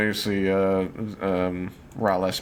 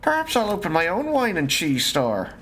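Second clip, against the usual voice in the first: higher, lilting character voice; in-character adventurer; 14 semitones higher than usual. Acted. Adventurer eyes a cheese wedge, higher character voice. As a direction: muse over loot in a put-on high voice.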